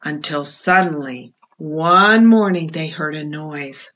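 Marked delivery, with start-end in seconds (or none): none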